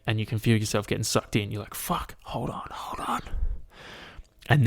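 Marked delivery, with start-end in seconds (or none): 1.7-3.2 soft, high, urgent whisper; whispered mock panic. Podcaster voices the ant getting sucked in, whispered mock panic. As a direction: whisper as if clinging on desperately.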